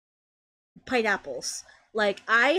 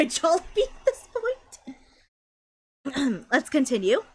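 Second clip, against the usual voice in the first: ordinary talk, nothing marked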